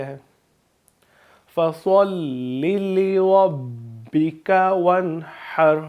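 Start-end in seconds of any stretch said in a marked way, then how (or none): 1.5-5.8 slow, drawn-out chant of the verse; Quranic recitation. Shown as a demonstration. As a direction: stretch each syllable in a recitation tune. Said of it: "اب میں تلاوت کروں گا".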